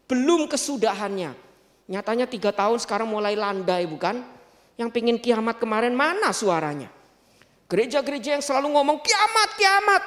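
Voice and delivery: ordinary talk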